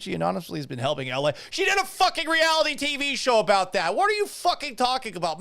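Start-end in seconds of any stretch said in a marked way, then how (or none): 1.5-5.4 high-pitched, indignant outburst; exasperated. Real, not acted. Host arguing with an anonymous commenter, exasperated. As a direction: let loose in disbelief at a foolish claim.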